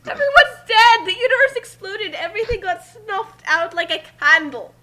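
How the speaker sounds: hammed-up wail. Joking with the group about the destroyed universe, mock wailing. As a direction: overact grief for comic effect.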